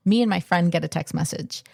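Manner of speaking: usual speaking voice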